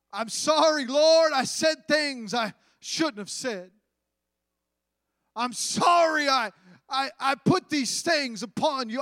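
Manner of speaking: loud, high pleading cry of sorrow; pleading apology. Acted. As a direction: cry out sorry to God, desperate. Job's repentance acted aloud.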